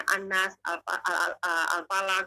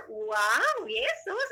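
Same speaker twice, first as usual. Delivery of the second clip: astonished; much slower than usual. Retold or imitated. Reacting to the voice naming itself, astonished. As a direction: question the name in amazement.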